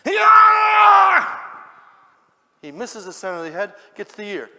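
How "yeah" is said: long, high, full-force yell; high sustained attack cry; battle yell. Acted. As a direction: swing the sword with a huge roar. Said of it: "Peter goes like this".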